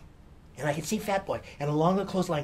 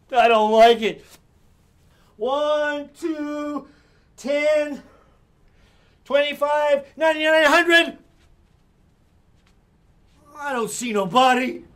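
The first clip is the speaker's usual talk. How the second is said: put-on high, loud whining kid; whiny Fat Boy; 9 semitones higher, 10 dB louder, slower than usual. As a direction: whine like a sulky boy who must be it. Fat Boy counting, seeking.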